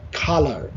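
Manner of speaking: "color" with a puffed kh at the start; corrected pronunciation. Shown as a demonstration. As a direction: say "color" slowly as khalar, strongly aspirated. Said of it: "তোমার সাউন্ডটা follow করবা". ("color"-এর শুরুতে জোরালো খ, ধীরে)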